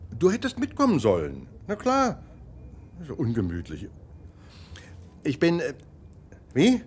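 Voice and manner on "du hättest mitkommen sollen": usual speaking voice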